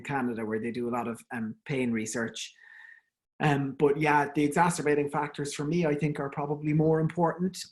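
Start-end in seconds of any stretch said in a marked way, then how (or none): none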